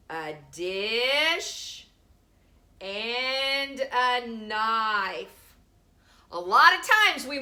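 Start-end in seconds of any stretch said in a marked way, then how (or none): 0.1-5.5 stretched slow teacher word; slowly, dwelling on each word; drawn out slowly. Shown as a demonstration. Teacher to pupils, slow stretched phrase. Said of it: "Uppercase D and lowercase d".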